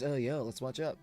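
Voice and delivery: usual speaking voice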